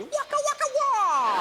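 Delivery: high comic waka waka wah; cartoon wah-wah. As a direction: sing out a silly sad trombone. Comic sound effect after [name] quote.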